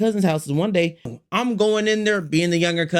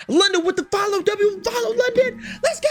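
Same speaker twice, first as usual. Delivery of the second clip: high, excited shoutout; high, lively thanks to a follower; hype shoutout; 12 semitones higher than usual. Real, not acted. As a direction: cheer the new follower. Shoutout to a viewer.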